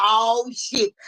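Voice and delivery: ordinary delivery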